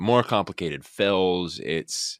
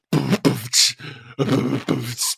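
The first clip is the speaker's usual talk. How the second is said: percussive mouth-drum beat; beatbox kicks and cymbal hits; imitated drum kit; 5 semitones higher, 4 dB louder than usual. Actor mimicking drummers overheard before the audition, mouthed drum beat.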